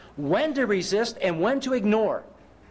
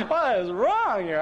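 slow, laughing, higher mock puzzlement; laughing bewilderment; 4 semitones higher, slower than usual. Real, not acted. Preacher laughing about being singled out, slow and amused. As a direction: drag it out through a laugh.

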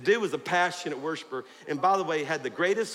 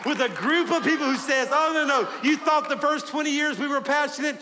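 loud, high revival preaching; full-force preaching; 7 semitones higher, 3 dB louder than usual. Real, not acted. Pastor fires up his church for the new year, preaching loudly. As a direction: preach it with fire.

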